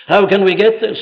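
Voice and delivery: ordinary talk, nothing marked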